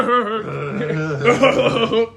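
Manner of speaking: loud, put-on chuckle; Seth Rogen laugh. Doing a celebrity's laugh as a joke, hammed-up chuckle. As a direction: force out a hammed-up goofy laugh. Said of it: "Seth Rogen laugh".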